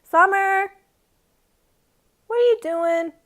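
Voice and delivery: loud, high-pitched call to a pet. Real, not acted. Owner calling to her meowing cat, loud call.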